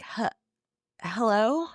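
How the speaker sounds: frightened yet hopeful, rising hello; acted vulnerable, cracking question. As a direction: call out hesitantly, as if hoping for an answer. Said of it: "his voice cracks and he's like".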